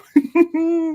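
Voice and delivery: high chuckle; amused laugh. Real, not acted. Host amused by a fan's old match story, real chuckle. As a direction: let out a short, high laugh.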